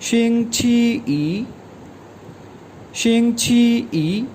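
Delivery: शिंग छी ई spoken slowly and deliberately for students; teacher slowly pronouncing शिंग छी ई; slow model word. Shown as a demonstration. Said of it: "इसे pronounce किया जाता है".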